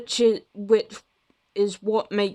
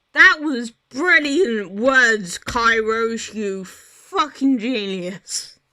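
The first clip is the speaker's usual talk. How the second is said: sarcastic; 3 semitones higher, 6 dB louder, slower than usual. Real, not acted. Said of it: "self-mocking after a flub".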